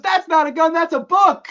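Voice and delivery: high, loud exasperated correction; indignant movie quote. Retold or imitated. Quoting the movie's comeback line, high and loud. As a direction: snap back as the wronged guy. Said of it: "He goes".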